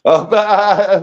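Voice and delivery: laughing through the words; breaking into real laughter. Real, not acted. Laughing at the boyfriend joke, laughing.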